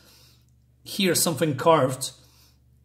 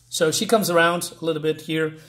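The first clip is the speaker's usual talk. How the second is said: ordinary delivery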